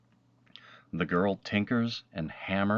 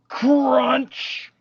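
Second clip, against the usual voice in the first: much higher, loud sound-effect shout; 9 dB louder than usual. A narrator's reading. Narrator acting the crushing noise for listening children, a loud high shout. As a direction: bang it out like the crash itself.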